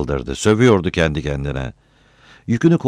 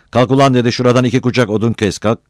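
loud, raised, fast-paced command; barking order; 7 semitones higher, 6 dB louder, faster than usual. A narrator's reading. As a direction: order him roughly to get moving. Gruff order in an acted quarrel.

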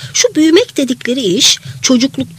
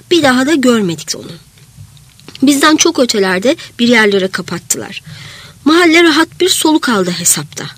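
plain reading voice

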